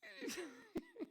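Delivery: a real high little laugh; high giggle. Real, not acted. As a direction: burst out laughing at the thought. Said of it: "I'm laughing".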